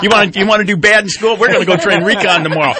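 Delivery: loud, high-pitched teasing dad threat; mock stern dad. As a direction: play a dad threatening his kid, half laughing. Joking as a dad threatening his kid.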